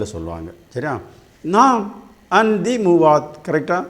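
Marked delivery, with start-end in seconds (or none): 1.4-3.5 நாம் set apart by a short pause, then "and the மூவாத்"; pause after நாம். Shown as a demonstration. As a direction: leave a little space after நாம். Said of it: "கொஞ்சம் space விட்டு".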